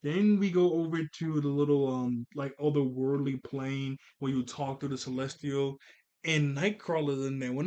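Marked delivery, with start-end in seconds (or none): none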